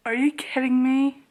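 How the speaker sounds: normal talking voice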